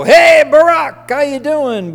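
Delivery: high, loud buddy greeting; casual greeting. Retold or imitated. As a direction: call out like an old friend. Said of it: "they could go".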